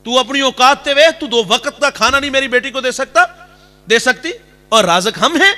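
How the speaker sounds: mock angry brother. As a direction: scold her with contempt. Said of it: "بھائی کے تیور دیکھنے والے".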